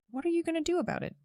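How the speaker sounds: high, fast mocking sneer of a smug cop. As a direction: toss it off in a high, quick mock taunt. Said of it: "he just had this smug look on his face".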